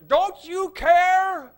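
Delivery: very loud, high, slow cry; panicked cry. Acted. Disciples crying to sleeping Jesus, loud high panic. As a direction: yell it like men in a sinking boat.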